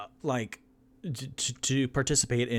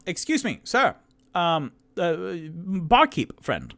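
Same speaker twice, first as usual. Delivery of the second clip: calling out; 10 semitones higher, 8 dB louder than usual. Acted. Calling barkeep loud high.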